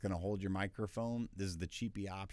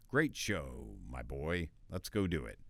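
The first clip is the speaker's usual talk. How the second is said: lightly voiced old statesman; 6 semitones lower than usual. Shown as a demonstration. Second historical figure voiced.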